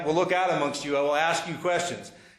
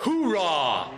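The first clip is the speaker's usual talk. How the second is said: loud gung-ho soldier; 2 semitones higher than usual. Shown as a demonstration. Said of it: "Can you teach us how to say "oorah"?".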